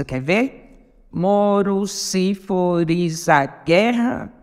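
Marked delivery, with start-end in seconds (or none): 1.1-4.3 very slow, syllable by syllable; contando sílabas. Shown as a demonstration. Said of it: "é a mesma coisa". (escandindo devagar, sílaba por sílaba)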